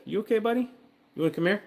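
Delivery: sweet high coaxing voice to a pet; high dog talk. Real, not acted. Streamer coaxing his nervous dog to come over, high pet talk. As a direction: call the dog over in a high, sing-song voice.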